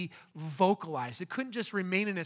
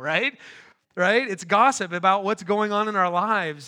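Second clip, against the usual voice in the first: louder and louder, rising "right?"; preaching build-up; 7 dB louder than usual. Acted. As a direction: build the crowd to a loud peak.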